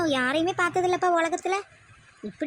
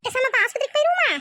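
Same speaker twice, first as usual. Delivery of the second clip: much higher, louder worried mother; anxious suggestion; 9 semitones higher, 3 dB louder than usual. Acted. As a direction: urge the hospital in a panic. Worried mother proposes hospital. (பதறிய அம்மாவின் உயர்ந்த குரல்)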